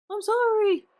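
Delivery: a voiced character blurting sorry; high loud apology. Character apologizing to the hero, high and loud.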